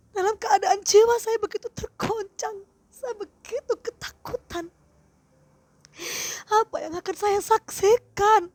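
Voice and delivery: distressed plea. Retold or imitated. Retold desperate plea to leader.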